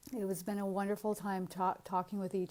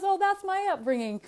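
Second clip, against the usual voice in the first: amused retold reply; 12 semitones higher, 10 dB louder than usual. Quotes man through her laughter.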